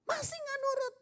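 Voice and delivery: very high parental warning. Acted. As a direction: pitch right up, one step before punishment. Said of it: "Nah, naik tuh intonasinya".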